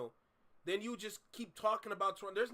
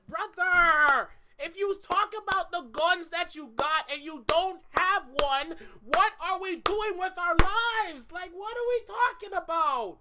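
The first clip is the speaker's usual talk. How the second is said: loud, higher, heated yelling; shouting rant; 6 semitones higher, 9 dB louder than usual. Real, not acted. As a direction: yell it out in disbelief.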